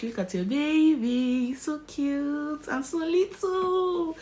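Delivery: put-on high, slow coo over the tiny baby; baby talk, drawn out sweetly; cooing doctor. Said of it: "He came to me, he was like".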